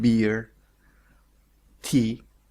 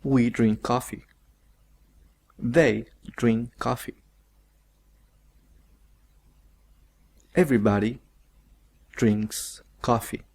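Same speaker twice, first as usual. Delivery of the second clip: slow model sentences. Shown as a demonstration.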